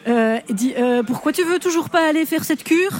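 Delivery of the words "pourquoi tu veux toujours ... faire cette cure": put-on un Pierre Bonnard joué assez haut, avec un accent bruxellois. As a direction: jouez Pierre en pressant sa femme de partir en cure. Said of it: "je fais Pierre Bonnard". (Pierre Bonnard, un peu aigu, à l'accent bruxellois)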